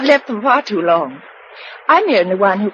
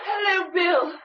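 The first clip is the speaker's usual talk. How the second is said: tearful greeting; 10 semitones higher, 7 dB quieter than usual. Acted.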